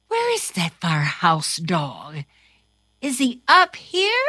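plain reading voice

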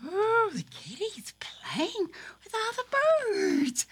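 whispered puppet delight; hushed and happy. Acted. Puppet cat watching kitty play with birds, whispering.

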